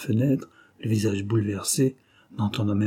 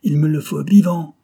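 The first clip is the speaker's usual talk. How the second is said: higher, louder insistence; loud command; 6 semitones higher, 8 dB louder than usual. A narrator's reading. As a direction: insist he must be taken alive. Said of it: "lança encore Richter".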